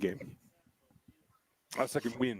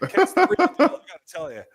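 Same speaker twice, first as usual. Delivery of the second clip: laughing loud; 6 semitones higher, 13 dB louder, faster than usual. Real, not acted. Laughing hype about rival quarterback.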